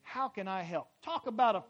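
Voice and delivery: preaching at full force. Acted. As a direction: build the sermon to a shout.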